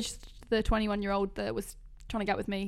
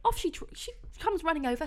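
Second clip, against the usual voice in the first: ordinary talk, nothing marked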